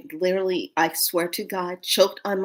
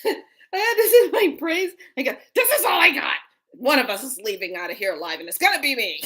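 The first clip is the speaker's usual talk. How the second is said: laughing; 5 semitones higher than usual. Real, not acted. Laughing while telling ambush fear.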